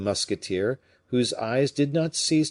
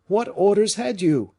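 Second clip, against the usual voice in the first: high, louder urgent demand; anxious cry; 11 semitones higher, 6 dB louder than usual. A narrator's reading. Fouquet demanding his own orders, high anxious cry. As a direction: demand it fearfully. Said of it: "cried Fouquet".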